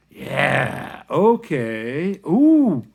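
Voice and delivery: loud, drawn-out, climbing in pitch; acted big reaction. Teacher taking in the student's credo, loud rising reaction. Acts out his awed reaction.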